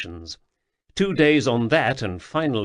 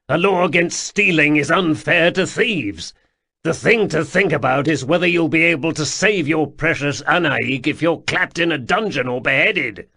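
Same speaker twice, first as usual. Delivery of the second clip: louder, sharp lecturing; stern rebuke; 5 semitones higher, 5 dB louder than usual. Acted. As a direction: scold him like a fool. Sul lectures Atropos harshly.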